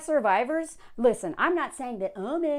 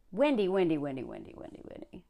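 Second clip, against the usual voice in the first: name repeated, sinking softer down to a breath; fading to a whisper; 6 semitones lower, 7 dB quieter than usual. Real, not acted. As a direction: let it die away into a whisper. Exasperated repeating the columnist's name.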